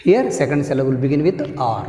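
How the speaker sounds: usual speaking voice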